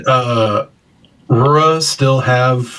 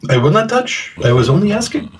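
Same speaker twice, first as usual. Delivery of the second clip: higher, meek robot reply; hurt droid voice; 7 semitones higher than usual. Acted. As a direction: back off, a little wounded. Droid answers after being snapped at.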